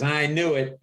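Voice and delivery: slightly put-on host voice; lightly voiced talk-show host. Retells Leno's reaction on phone.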